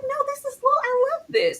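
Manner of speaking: high-pitched delighted squeal of a quote; gushing, way up high; retold excited reaction.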